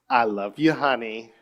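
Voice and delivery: mumbled, no warmth; flat, careless. Shown as a demonstration. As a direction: toss it off without care. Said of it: "Right, I said it".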